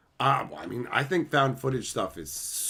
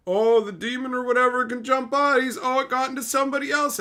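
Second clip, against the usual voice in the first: mocking; 13 semitones higher, 5 dB louder than usual. Mocking the film's twist ending.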